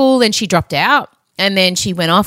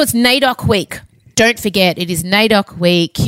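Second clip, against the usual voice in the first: normal talking voice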